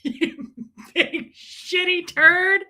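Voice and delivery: slow, drawn-out mock insult; slow, relishing echo of the ranter; savouring the insult. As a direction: relish every word.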